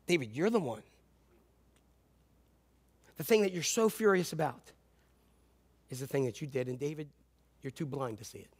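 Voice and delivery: gently but firmly name his sin; a hushed prophet's rebuke; quiet rebuke. Retold or imitated. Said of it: "He was saying".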